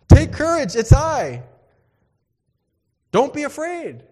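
high, loud call across the water. Acted. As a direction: shout reassurance over the wind. Jesus calling over the storm.

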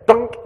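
a sharp high "Dunk!" for the hit; sound effect dunk. Retold or imitated. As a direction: smack out the thud as a word. Sound of hitting gopher.

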